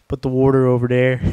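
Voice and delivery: broad Philly accent, water as wooder. Shown as a demonstration. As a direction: Put the water over there in a Philadelphia accent. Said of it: "in a Philly accent?".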